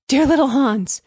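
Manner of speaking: crying out. A narrator's reading. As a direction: call out urgently to a friend. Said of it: "cried the miller".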